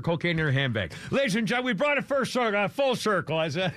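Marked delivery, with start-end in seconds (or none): 1.1-3.4 high-pitched showman's proclamation; mock announcer. Host jokingly announcing a full circle, mock announcer. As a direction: announce it grandly, in jest.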